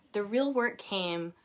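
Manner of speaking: plain reading voice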